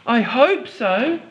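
put-on high, slow little girl; a hopeful small girl; Molly's voice. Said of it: "said Molly".

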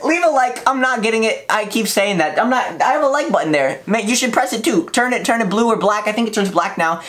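ordinary delivery

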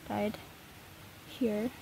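ordinary talk

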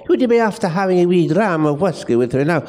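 hammed-up Scottish Highland accent. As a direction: overplay a Highland Scots brogue. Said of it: "do it with a Highland accent".

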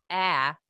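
loud open-mouthed hit cry; open-jaw pain cry. Shown as a demonstration. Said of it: "that's your jaw falling open".

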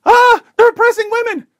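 high, loud hysterical shriek; mock scream. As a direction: shriek it like a panicked reporter. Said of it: "and screams".

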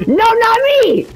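high panicked shriek; panicked scream. Real, not acted. Chased by a game boss.

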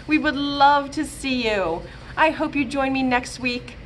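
ordinary delivery

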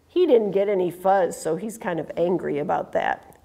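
plain reading voice

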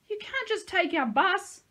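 very high, slow, loud protest; outraged shriek. A narrator's reading. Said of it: "Batiste shrieked".

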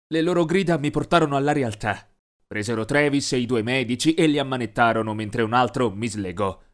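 plain reading voice

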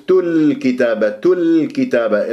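تل كتابة with the l briefly held before k; sounded lām. Shown as a demonstration. Teacher tells students to look, lām held in تل كتابة. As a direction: let the lām ring in تل كتابة. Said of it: "يجب أن يجري فيها صوت فترة زمنية".

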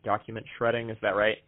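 ordinary talk

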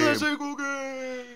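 high, slow, soft title echo; soft high game show echo; mock announcer echo. Echoing segment title.

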